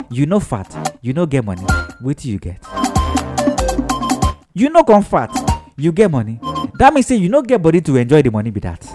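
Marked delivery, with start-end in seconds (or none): none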